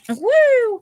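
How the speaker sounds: high, loud "woo" after a laugh; startled laughing whoop. Real, not acted. Cutting went its own way, laughing high whoop. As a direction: let out a big whooping "woo". Paper slips while she is cutting.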